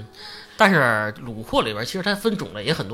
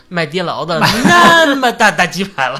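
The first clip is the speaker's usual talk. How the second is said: laughing; 5 semitones higher, 9 dB louder, slower than usual. Real, not acted. Exaggerating a huge chicken cutlet.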